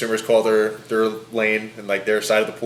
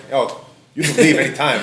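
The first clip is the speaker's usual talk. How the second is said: snapping; 3 semitones higher, 5 dB louder than usual. Retold or imitated. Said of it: "I've snapped on people".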